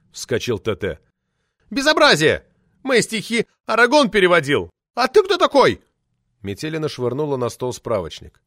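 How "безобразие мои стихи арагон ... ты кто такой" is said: put-on high, loud indignant shriek of an old party lady; shrill furious official lady yelling; roaring outrage. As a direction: howl it out like an enraged sabre-toothed tigress. Outraged poetess rants.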